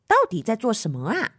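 ordinary delivery

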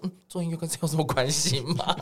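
amused, half-laughing comeback; laughing retort. Retold or imitated. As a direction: laugh through a puzzled reply. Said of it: "我想说". (笑着反问)

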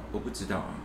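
mock bored shop clerk; indifferent clerk. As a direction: brush the customer off, barely bothering. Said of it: "她的态度就是那种".

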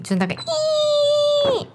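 long high squeal like a rusty hinge; creaky metal cabinet. Retold or imitated. Retelling the radio drama, mimicking a rusty cabinet. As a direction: stretch out a shrill rusty creak. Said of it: "铁皮柜子的声音".

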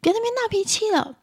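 high-pitched exasperated scolding; irritable mother. Acted. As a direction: act a mum fed up with a tantrum. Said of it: "暴躁的妈妈会说". (暴躁妈妈尖声数落)

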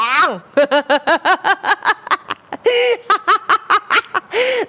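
long high breathy laughter; real laugh. Real, not acted. Teller cracking up at telling mom, high laughter. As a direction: crack up at your own punchline. Laughs after "just fat" punchline.

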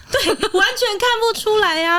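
real laughter; laughing. Real, not acted. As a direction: giggle it out in disbelief. Laughing about husband.

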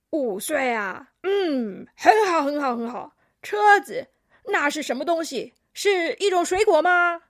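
loud, puzzled grandpa; old koala. A narrator's reading. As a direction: muse aloud, then question in a loud elder voice. Old koala quizzes the little alpaca.

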